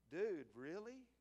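incredulous, rising retold reaction; mock freaked out. Onlooker thinking at an arguing coach, mock freaked out. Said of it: "I'm sitting up there freaking out".

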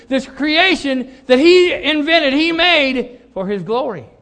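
loud, high preaching voice, building; full-force preaching. Acted. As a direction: preach it out to the congregation.

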